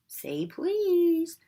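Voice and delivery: high sing-song taunt; teasing Peeves. Acted. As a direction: tease him coyly.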